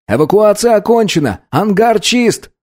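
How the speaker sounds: high, urgent cadet report; loudspeaker report. A narrator's reading. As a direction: announce it high over the intercom. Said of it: "доложил по громкой связи".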